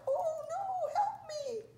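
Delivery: very high, drawn-out cry for help; tiny trapped spider wailing for rescue; panicked plea. A narrator's reading.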